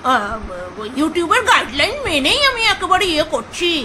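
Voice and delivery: drawn-out mimicry of a rival vlogger; mock quoting. Vlogger mocks a rival's guideline claim, drawn-out mimicry. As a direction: mimic her claim with a long, swooping drag. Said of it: "আবার বলছিল".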